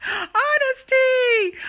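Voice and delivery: high, loud, drawn-out cry; shouted exclamation. Real, not acted. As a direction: belt the word out. Exclaims the key word.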